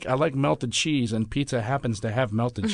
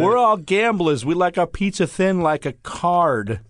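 louder, higher Brooklyn tough guy; Brooklyn accent; 6 semitones higher, 5 dB louder than usual. Acted. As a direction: play a Brooklyn gambler type. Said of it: "You guys are good at accents".

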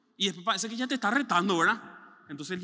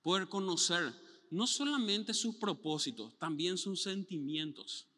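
slow, soft and tender; sincere, slow; 4 dB quieter, slower than usual. Real, not acted. Pastor closing on intimacy with God, slow and tender. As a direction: draw them in gently.

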